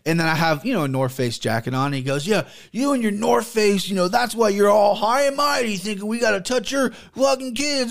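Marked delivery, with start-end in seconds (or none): 2.3-7.9 higher sneering impression of the ranting guy; mocking rant. The guy sneering about his North Face jacket, mock higher voice.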